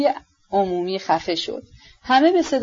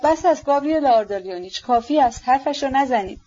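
higher, urgent plea to stop; alarmed cry; 6 semitones higher than usual. A narrator's reading. The Prince, alarmed, begging Gavrila to stop: higher urgent cry. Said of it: "پرنس وحشت زده فریاد زد".

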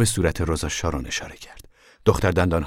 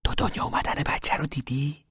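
breathy unvoiced whisper, higher pitched; 7 semitones higher, 5 dB quieter than usual. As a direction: whisper so the grown-ups can't hear. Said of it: "روتی نجوا کنان گفت".

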